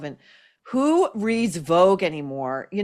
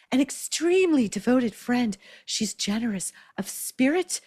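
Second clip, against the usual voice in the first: mock baby voice, pitched up; SJP; 6 semitones higher than usual. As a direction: imitate an actress talking like a baby. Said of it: "I'm gonna do it in S J P's baby voice".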